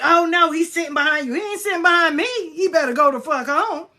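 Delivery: high, loud mock-argument voice; acting out a heated comeback; indignant retort. Acted heated exchange with reader.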